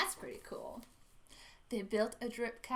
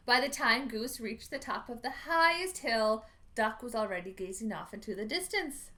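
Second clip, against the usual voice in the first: ordinary delivery, nothing marked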